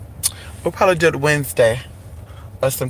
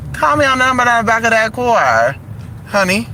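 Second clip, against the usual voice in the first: sass it, less squeaky; higher sassy take; 6 semitones higher than usual. Shown as a demonstration. Showing the car line multiple ways, sassy higher voice. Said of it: "I can say it multiple ways".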